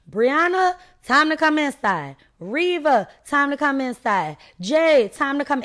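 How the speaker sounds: high, singsong porch call. Retold or imitated. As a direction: call the kids in like a mama. Mamas calling children in from porch.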